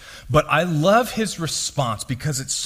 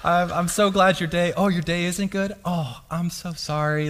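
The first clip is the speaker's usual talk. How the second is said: higher, soft sweet phone voice; boyfriend voice; 4 semitones higher, 3 dB quieter than usual. Retold or imitated. Husband answering his wife's phone call in a sweet boyfriend voice. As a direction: coo sweetly to your wife. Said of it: "what's called a boyfriend voice".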